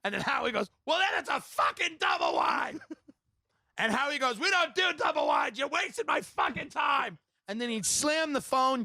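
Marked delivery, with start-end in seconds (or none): 0.8-2.8 high-pitched exasperated yelling; angry mock yell. Salesman blowing up at the customer on the phone, angry mock yell. 4.4-7.2 high-pitched irate phone rant; angry mock yell. Salesman about to slam the phone on a customer, angry mock yell.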